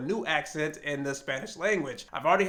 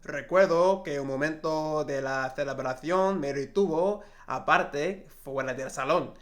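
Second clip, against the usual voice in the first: reading Spanish prose in a Castilian accent from Spain; 2 semitones lower than usual. Shown as a demonstration. Reading a novel aloud to practise her Spain Spanish accent. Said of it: "read and then try to use my Spanish accent".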